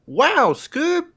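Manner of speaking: Shaggy impression. Second try at Shaggy, squeaky high Shaggy. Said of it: "Jesus Christ".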